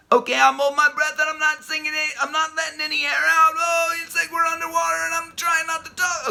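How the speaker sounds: high and loud, pinched as if holding the breath; exaggerated breath-holding voice. Shown as a demonstration. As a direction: talk like you're underwater holding air in. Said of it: "an over-accentuated example".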